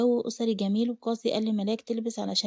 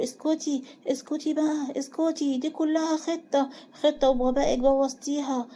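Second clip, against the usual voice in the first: raised pitch, mounting anger; angry, reckless; 4 semitones higher than usual. A narrator's reading. Qasi silencing Suzan as he reveals the plan, angry. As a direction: shut her up with heated, insistent force. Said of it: "قاسي بغضب وتهور".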